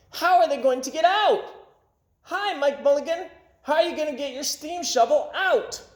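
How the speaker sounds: high, loud calling down; crowd calling. A narrator's reading. Townspeople calling down to Mike Mulligan, high. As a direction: call down into the cellar. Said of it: "Then everybody said".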